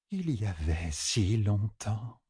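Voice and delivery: soft, slow, entranced voice; wistful oracle woman. A narrator's reading. Other woman finishing the thought, soft slow oracle. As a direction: breathe it out slowly, very softly.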